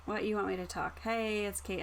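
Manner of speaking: ordinary talk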